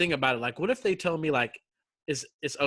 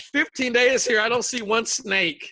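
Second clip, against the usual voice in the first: loud, higher, exasperated complaint; acted indignation; 6 semitones higher, 5 dB louder than usual. Hungry man on stretcher hears of snake, acted indignation. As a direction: protest the injustice in a retold outburst.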